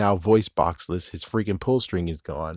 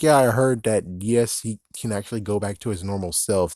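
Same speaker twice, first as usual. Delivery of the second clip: usual speaking voice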